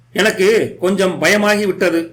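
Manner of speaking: usual speaking voice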